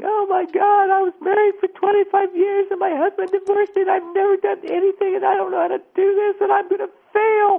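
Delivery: hammed-up crying woman, high and loud; imitated sobbing woman. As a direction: mimic a woman falling apart in tears. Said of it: "And she starts sobbing".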